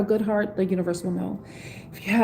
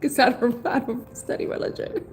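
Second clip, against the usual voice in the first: laughing; 2 semitones higher than usual. Real, not acted. Streamer admits not knowing other religions, laughing. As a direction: laugh while admitting it, words tumbling out. Amused at own lack of knowledge.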